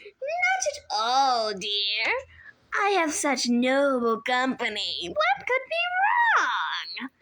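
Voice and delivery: put-on high, sugary old woman's voice, a touch foreign; sweet old lady voice. A narrator's reading. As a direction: coo it like a kindly grandmother hiding a threat. Auntie Em feigning innocence.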